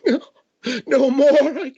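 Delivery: very high, loud whimpering wail; cowering man. Acted. Shaking man begs the one standing over him, wailing. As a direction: wail the plea far above your own pitch. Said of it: "and he's just shaking".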